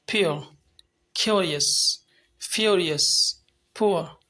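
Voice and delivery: सिक्योरिटी, प्योर, पुअर said carefully; ua glide demo words. Shown as a demonstration. Teacher modelling words for students, careful glide. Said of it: "So this is the sound".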